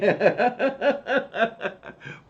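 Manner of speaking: loud high laugh; real laughter; burst of laughter. Real, not acted. After host admits not listening.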